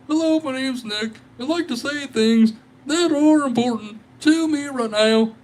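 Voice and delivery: high, silly voice parodying himself; squeaky mock-announcer self-parody; mock self-important voice. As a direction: ham up a pompous streamer blathering. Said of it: "I'm just over here just like".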